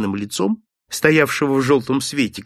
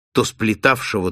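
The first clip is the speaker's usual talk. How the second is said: usual speaking voice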